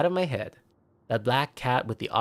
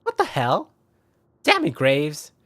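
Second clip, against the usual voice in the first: indignant outburst; 4 semitones higher, 6 dB louder, slower than usual. Acted. Indignant at the cat.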